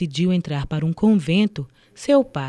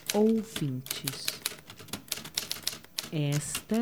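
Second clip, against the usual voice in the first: slow dramatic intro; 9 dB quieter, much slower than usual. Acted. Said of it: "broadcast intro delivered slowly".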